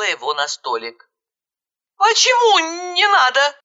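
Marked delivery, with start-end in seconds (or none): 2.0-3.6 high, confused question; bewildered. A narrator's reading. Narrator puzzled by injured girl's plea, bewildered. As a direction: ask in pitched-up bewilderment. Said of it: "растерянно спросила я".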